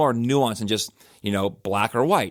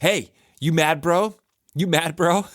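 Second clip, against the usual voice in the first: imitated taunting yell; 6 semitones higher, slower than usual. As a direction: call out a taunt as if from the sideline. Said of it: "started yelling at Tom from the sideline and yelling".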